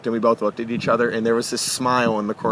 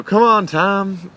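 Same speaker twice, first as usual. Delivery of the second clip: calling out; 9 semitones higher, 4 dB louder, much slower than usual. Retold or imitated. Bruce calling him in.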